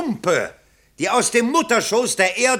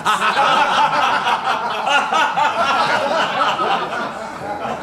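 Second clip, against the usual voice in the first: flattered laugh; 2 semitones higher than usual. Acted. Candidate laughs at the praise.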